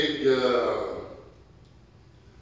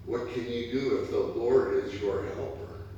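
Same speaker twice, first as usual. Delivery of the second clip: ordinary talk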